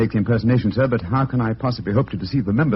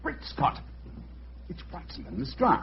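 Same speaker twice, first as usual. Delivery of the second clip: whispered alarm under the breath; hushed aside; 7 semitones higher, 11 dB quieter than usual. Acted. Holmes spotting Watson at the gate, whispered. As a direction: drop to a whisper as friends approach. Sees friends arrive, hides.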